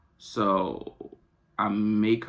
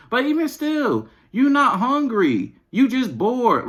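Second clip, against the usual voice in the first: exasperated scolding; 13 semitones higher, 5 dB louder, slower than usual. Real, not acted. Mother scolding her kids about eating, high and loud.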